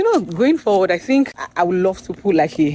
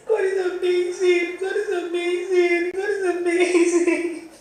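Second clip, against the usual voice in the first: crying; 13 semitones higher than usual. Real, not acted. Author praising God on camera, really crying. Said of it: "I cried, guys".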